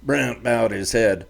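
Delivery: plain reading voice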